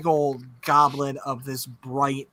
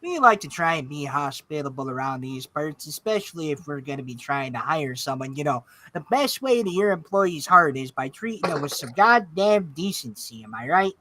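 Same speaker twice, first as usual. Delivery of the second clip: folksy, hospitable Midwestern; Midwestern accent. Acted. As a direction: neighborly boss with a lilt. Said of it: "Midwestern Spark because accents".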